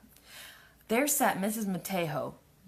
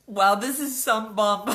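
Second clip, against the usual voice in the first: amused; 3 semitones higher, 9 dB louder, slower than usual. Real, not acted. Mocking her childhood story.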